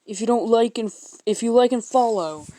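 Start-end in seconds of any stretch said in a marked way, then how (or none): none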